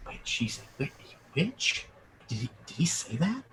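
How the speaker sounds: hushed, shocked crowd whisper; murmuring crowd. Acted. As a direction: whisper it like spreading gossip, rising to questions. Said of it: "you hear the echo circulate".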